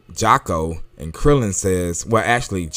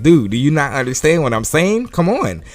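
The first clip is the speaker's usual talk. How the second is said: louder, higher mock exasperation; exasperated; 6 semitones higher, 5 dB louder than usual. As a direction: push it up into an impatient "come on". Piccolo impatient with stunned Vegeta.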